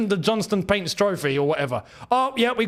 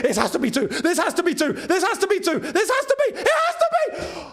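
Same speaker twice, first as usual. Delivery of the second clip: climbing higher and higher while laughing; laughing excitement; 9 semitones higher than usual. Real, not acted. Fan begging for a second goal, laughing and rising. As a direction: build from a laugh to a squeal of joy.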